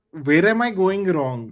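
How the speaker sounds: ordinary delivery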